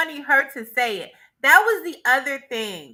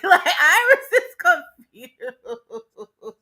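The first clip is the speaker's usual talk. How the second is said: louder, slow, dissolving into laughter; real laughter; 5 dB louder, much slower than usual. Real, not acted. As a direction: laugh right through the words. Cracking up over Iris's reaction.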